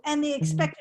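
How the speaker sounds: ordinary delivery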